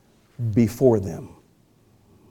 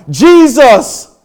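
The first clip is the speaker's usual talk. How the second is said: high, loud cry dropping to a hushed plea; 6 semitones higher, 7 dB louder, much slower than usual. Retold or imitated. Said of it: "cried out".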